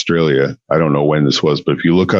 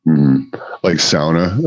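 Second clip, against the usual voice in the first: ordinary talk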